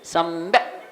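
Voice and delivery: सम्यक with held m, stopped dead on k; pure final consonant. Shown as a demonstration. Teacher showing the halant ending, pure final consonant. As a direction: end सम्यक on a bare k, no vowel. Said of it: "शुद्ध व्यंजन है".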